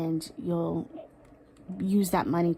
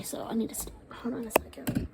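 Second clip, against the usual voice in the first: whispered through tears, pulling herself together; hushed pause to compose herself; tearful whisper; 4 semitones higher, 6 dB quieter than usual. Real, not acted. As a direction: breathe and whisper past the tears. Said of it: "she's making me cry".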